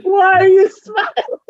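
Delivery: very high, tearful kid imitation; mock crying child. Mom imagining her daughter's reaction, mock crying. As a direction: squeal it like an upset child, hammed up. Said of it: "she'd start crying".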